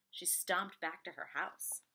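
ordinary delivery, nothing marked

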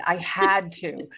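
slow "had", leaned on hard; stress on HAD. Shown as a demonstration. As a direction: stretch out HAD as the key word. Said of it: "Operative word being had".

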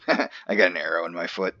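ordinary talk, nothing marked

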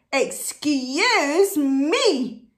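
loud, very drawn-out protest; loud storybook rabbit shout; indignant shout. Acted. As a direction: shout it slowly, stretching each word. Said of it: "shouted the rabbit".

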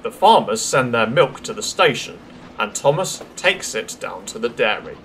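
usual speaking voice

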